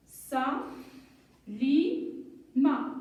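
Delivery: "sa, li, ma" with long pauses between; syllable by syllable. Shown as a demonstration. Teacher building a name, "sa, li, ma" widely separated. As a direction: hold each syllable apart before blending. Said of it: "un petit jeu de lecture".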